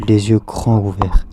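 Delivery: ordinary delivery, nothing marked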